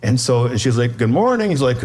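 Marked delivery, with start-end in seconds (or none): 1.0-1.5 breezy greeting. Retold or imitated. Wife greets the departing guest, breezy greeting. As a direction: imitate an untroubled greeting, pitch lifted well up. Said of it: "kind of the walk of shame".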